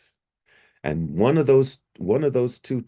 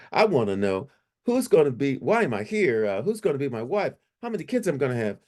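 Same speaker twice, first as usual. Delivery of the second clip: higher-pitched string of eager questions; insistent questioning; 7 semitones higher than usual. Retold or imitated. Man putting his questions for God to an angel, insistent. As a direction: press for answers, voice up.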